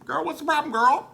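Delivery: high, loud, sassy; sassy reassurance. Retold or imitated. Trinity bossing Jinx, high and loud. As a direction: take charge with big sass. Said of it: "Trinity's like".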